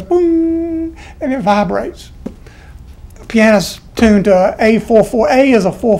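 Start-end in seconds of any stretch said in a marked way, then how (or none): 0.0-0.9 long, high, steady "booom"; imitated tuning-fork hum. Piano player hitting a tuning fork, imitated as a sustained ringing note. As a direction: ring it out like a struck tuning fork. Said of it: "you hit it, it goes".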